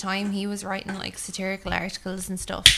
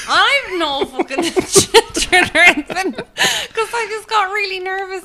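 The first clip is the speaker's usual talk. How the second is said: high, loud, breaking up with laughter; helpless laughter through the words, slowing down; laughing hard; 11 semitones higher, 11 dB louder, slower than usual. Real, not acted. Confessing her babysitting excuse was made up, laughing hard.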